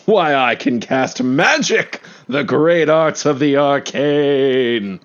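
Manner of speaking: slow, theatrical arcane proclamation; grand wizard boast. Acted. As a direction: boast of magic like a showman. Character boasts of his magic.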